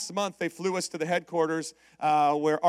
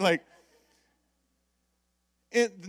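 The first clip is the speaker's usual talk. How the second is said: ordinary talk, nothing marked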